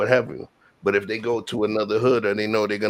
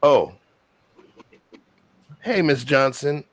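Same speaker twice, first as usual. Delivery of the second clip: respectful greeting; 6 semitones higher, 5 dB louder than usual. Retold or imitated. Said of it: "people to know that".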